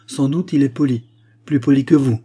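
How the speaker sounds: sharp, loud and high cutting reply; a younger sister's sudden burst of temper; angry retort. Acted. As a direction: spit the insult back at him. Silence fighting down her rage.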